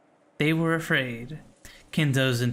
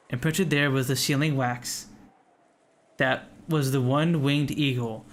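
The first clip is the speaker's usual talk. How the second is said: usual speaking voice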